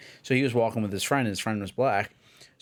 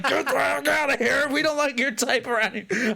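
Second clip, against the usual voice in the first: very high mock hollering; hammed-up, high-pitched heckler; imitated angry shout; 13 semitones higher than usual. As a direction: play an outraged man chasing people off. Acted angry man with walking stick.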